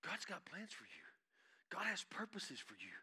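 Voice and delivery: hushed, breathless whisper; sudden pulpit whisper. Acted. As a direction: drop to an intimate whisper for the congregation. Sudden hush after loud preaching.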